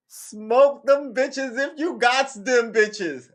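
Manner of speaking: high, loud, drawn-out holler; hyped-up shout. Pretend. Host cheering on smokers after legalization news, hyped-up shout. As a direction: holler it out slowly like a rallying cry.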